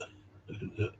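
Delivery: breathy whispered echo. Retold or imitated. Echoing the woman's confession in a whisper. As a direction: let it fall to a whisper.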